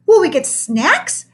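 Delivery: put-on high, slow kid question; child voice. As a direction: ask hopefully like a kid. Hopeful child asks.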